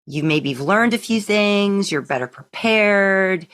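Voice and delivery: ordinary talk, nothing marked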